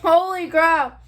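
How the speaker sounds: surprised shout. Real, not acted. Reacting to sour candy.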